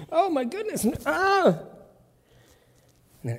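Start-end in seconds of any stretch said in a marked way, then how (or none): 0.1-1.6 panicked gasp. Acted. Disciples panicking at God's voice.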